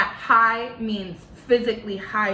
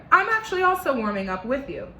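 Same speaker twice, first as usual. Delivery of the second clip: usual speaking voice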